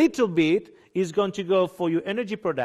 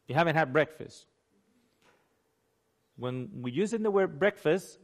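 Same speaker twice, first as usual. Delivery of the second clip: ordinary talk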